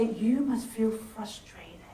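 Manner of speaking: soft and slow, naming the feeling for them; empathetic reflection. Shown as a demonstration. Teacher demonstrating empathy to a frustrated listener, soft and slow. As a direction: gently reflect their frustration back. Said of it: "And they say".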